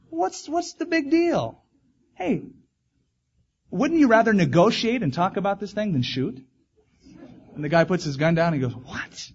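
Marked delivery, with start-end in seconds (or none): 0.1-6.4 a mildly voiced talking bear pleading gently; comforting bear. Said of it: "in this kind of a comforting tone". 8.8-9.3 whispered, breathless what; a hunter stunned by a talking bear; startled what. Acted.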